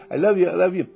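loud, high gushing "I love you"; loving coo. Shown as a demonstration. Gushing love to a seed in the dirt, loud and high.

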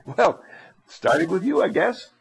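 ordinary delivery

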